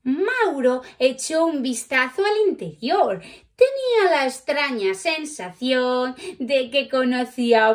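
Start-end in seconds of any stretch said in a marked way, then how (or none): none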